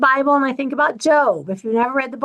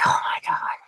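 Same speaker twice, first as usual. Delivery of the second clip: whispered, quieter, fed up; exasperated whisper; 7 dB quieter than usual. Retold or imitated. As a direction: breathe it out in disbelief. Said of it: "It's like".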